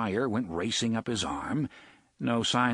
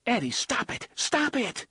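much higher, urgent cry to stop; alarmed plea; 12 semitones higher than usual. Acted. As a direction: cut him off, shocked and pleading. Susanna dismayed, stopping Eddie.

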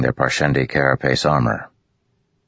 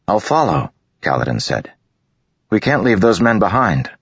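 firm insistence; 7 semitones higher than usual. A narrator's reading. Kaladin insisting.

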